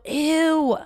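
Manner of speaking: high, loud mock-disgusted ew; parents' disgusted ew. Parents recoil at daughter's rental choice, a mock-disgusted ew.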